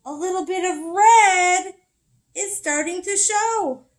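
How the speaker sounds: high, loud, slow turtle voice; Turtle's voice. Acted. Turtle telling the animals, high slow wonder.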